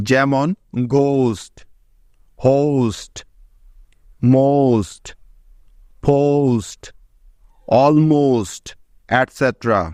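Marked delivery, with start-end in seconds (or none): 0.9-8.6 গোস্ট, হোস্ট, মোস্ট said one at a time, with ও gliding to ঔ before st; example words with ou. Shown as a demonstration. Teacher shows students how ঔ sounds before st, word by word. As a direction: give each word its own clear ঔকার, pausing after it. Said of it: "এবার দেখো ST এর কিছু উদাহরণ".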